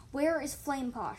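insistent, heated question; demanding. A narrator's reading. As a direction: demand an answer at once. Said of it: "she demanded".

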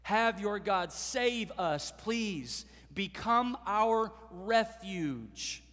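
pleading. Retold or imitated. Gibeonites pleading with God's people, slow begging. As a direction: plead for refuge, drawing each word out. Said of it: "Make a plea before God's people".

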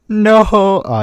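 sudden loud, high no; shocked shout. Real, not acted. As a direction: blurt it out in shock. Reacting to character killed.

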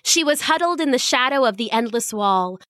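usual speaking voice

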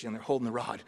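normal talking voice

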